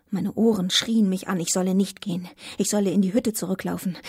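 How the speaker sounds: usual speaking voice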